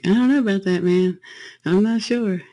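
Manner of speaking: mock everyone's reluctance in a lifted, unsure voice; doubtful listeners. Guide voices everybody balking at the road trip, doubtful. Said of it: "everybody would be like".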